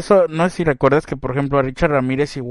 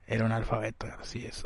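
whisper; 4 semitones lower, 16 dB quieter, faster than usual. Real, not acted. Whispered joke aside.

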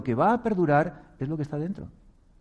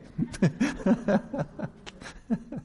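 high bright laugh; 9 semitones higher than usual. Real, not acted. Speaker laughing at the acted plea, real laugh. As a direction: laugh at your own joke.

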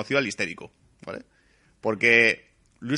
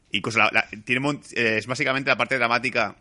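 usual speaking voice

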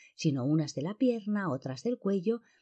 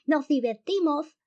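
very high, drawn-out lisping yell; grito casi ahogado de risa; 10 semitones higher, 5 dB louder than usual. A narrator's reading. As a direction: yell it gleefully from above, stretched out. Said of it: "le gritó desde arriba". (grito agudísimo, ceceante y alargado)

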